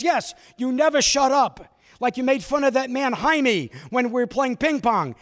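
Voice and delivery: loud, high fatherly scolding; father scolding his son in the car, loud. Retold or imitated.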